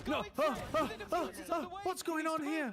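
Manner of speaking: high, loud panicked yelling; excited yelling. Real, not acted. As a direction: yell as the round unravels. Panicking while watching the round.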